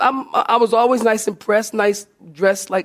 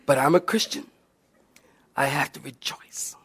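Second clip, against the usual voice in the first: low, very soft, nearly whispered; hushed brave acceptance, played for laughs; mock-martyr resignation; 7 semitones lower, 8 dB quieter than usual. Martyred inner monologue, played comically.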